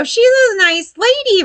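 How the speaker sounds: very high, gushing onlooker voice; mock sweet approval. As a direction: imitate someone cooing politely about her. Imagined polite onlooker's remark.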